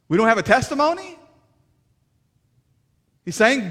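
loud, high, commanding sermon voice; impassioned sermon climax at full volume; full-force preaching. Acted. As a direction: send them out with fire.

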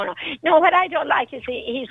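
ordinary talk

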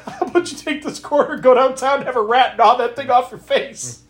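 lightly voiced gruff uncle telling off a kid; Uncle Buck. Host quoting a movie uncle mocking a face lump, lightly voiced. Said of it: "I kept thinking of Uncle Buck".

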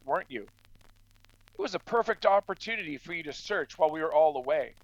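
usual speaking voice

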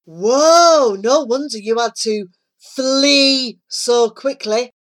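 mildly hammed-up jest; joking. A narrator's reading. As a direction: play up your own joke. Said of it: "grinning at his own joke".

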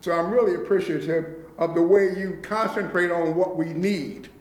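ordinary talk

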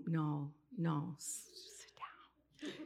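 urgent hushed plea to stop; hushed pleading. Retold or imitated. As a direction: whisper it under your breath. Silently begging the singer to stop.

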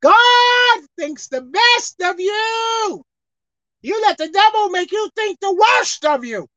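high, drawn-out preacher's proclamation; full-force preaching. Real, not acted. Preacher telling the congregation God thinks the best, forceful preaching.

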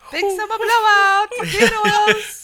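high, loud, slow shopkeeper; hearty sales call, laughing; Frozen innkeeper voice. Pretend. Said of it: "glad you picked up on that".

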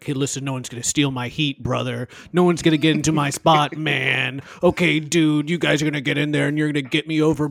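loud, fast Macho Man style rant; wrestler promo voice. Retold or imitated. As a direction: shout it like a pro wrestler cutting a promo. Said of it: "did he say anything like".

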